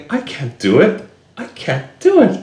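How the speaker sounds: put-on high whimpering little child; scared child voice. Shown as a demonstration. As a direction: whine it like a frightened kid. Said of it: "They're like, they're like saying".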